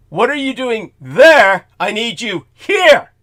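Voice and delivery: loud, slow, exasperated demand; retold shout. Caller to cab driver on the wrong spot, retold shout. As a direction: shout each word down the phone. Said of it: "and then shout at him".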